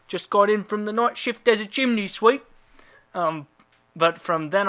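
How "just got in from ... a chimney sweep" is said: high, hammed-up British working-man accent; mock British chimney sweep. Joke customer reply after the night shift, exaggerated British accent. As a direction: play a cartoon British sweep.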